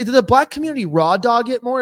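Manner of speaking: usual speaking voice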